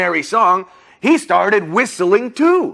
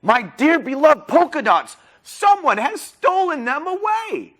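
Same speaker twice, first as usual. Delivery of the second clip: high-pitched, dismayed storybook lady; acted lament, climbing very high; Polka Dotty crying out; 9 semitones higher than usual. A narrator's reading. Distraught character finding her polka dots stolen, high lament. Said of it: "cried Polka Dotty".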